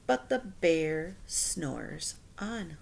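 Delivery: slow, soft, drowsy refrain; sleepy refrain. A narrator's reading. Reading the repeated line to children, slow and sleepy. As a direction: let it drift off like sleep.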